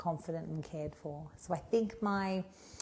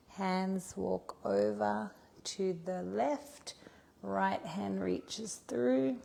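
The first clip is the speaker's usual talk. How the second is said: slow, louder, lilting instructor voice; yoga cueing; 3 semitones higher, 4 dB louder than usual. Acted. Yoga teacher cues the class through a shoulder stretch, slow and lilting. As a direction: guide the class through each stretch slowly.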